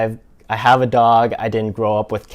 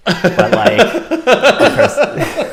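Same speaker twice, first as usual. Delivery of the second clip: giggling high through the words; laughing; 4 dB louder, much slower than usual. Real, not acted. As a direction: laugh it out, slowing down. Joking about being lizard person.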